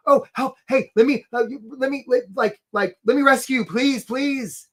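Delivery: high, stammering, over-eager pleading; hammed-up desperate pestering; needy job seeker. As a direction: beg clumsily as a pushy would-be rescuer. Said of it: "we're rowing up as the job seeker saying".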